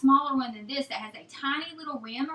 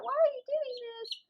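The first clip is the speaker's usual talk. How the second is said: put-on much higher, puzzled baby chick voice; mock chick voice, high and bewildered; voicing the chick; 11 semitones higher than usual. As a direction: play the confused chick asking why. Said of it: "So he's probably like".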